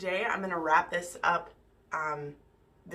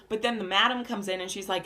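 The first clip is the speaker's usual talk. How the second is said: ordinary delivery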